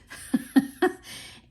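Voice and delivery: short high breathy chuckle; amused laugh. Real, not acted. Reading her old collage prompt to viewers, amused high chuckle. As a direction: laugh at the odd phrase. Laughing at odd found phrase.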